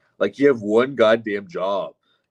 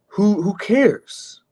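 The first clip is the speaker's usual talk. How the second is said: slow, much higher, stretched "who, who cares"; drawn-out who cares; 10 semitones higher, much slower than usual. Acted. Streamer brushing off whether a streamer is rich, slow high whine. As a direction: stretch it out in a squeaky mocking whine.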